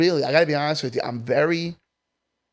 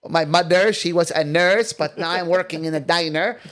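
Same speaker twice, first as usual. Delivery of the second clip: put-on a light Filipino accent, a touch louder; 3 semitones higher, 4 dB louder than usual. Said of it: "When I do that accent to the Filipinos".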